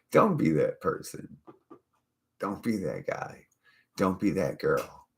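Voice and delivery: ordinary talk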